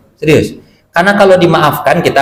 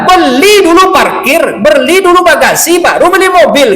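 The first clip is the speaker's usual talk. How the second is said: angry neighbour; 7 semitones higher than usual. Retold or imitated. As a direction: scold him like a furious neighbour. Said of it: "Marah dia".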